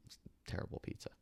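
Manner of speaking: unvoiced, fast aside; hushed quick side comment; whispered aside. Real, not acted. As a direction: whisper it to the listener. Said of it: "whispered aside".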